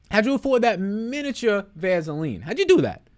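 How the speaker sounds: high, lightly put-on asker voice; mock questioner voice. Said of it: "imitating people asking".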